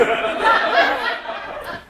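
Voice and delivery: a real burst of laughter; laughing aloud. Real, not acted. Storyteller laughing at her own tale. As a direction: laugh at the joke.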